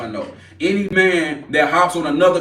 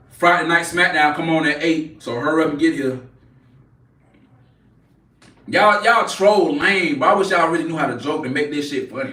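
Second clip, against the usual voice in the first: ordinary talk, nothing marked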